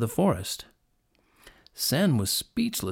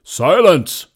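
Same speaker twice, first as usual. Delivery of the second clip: very loud, booming command, raised pitch; commanding lion; 6 semitones higher, 13 dB louder than usual. A narrator's reading. Lion hushes fretting animals, commanding shout. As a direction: cut them off with a shout. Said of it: "commanded the lion".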